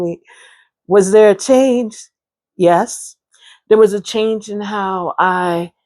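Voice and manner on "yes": ordinary talk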